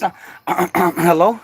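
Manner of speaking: ordinary talk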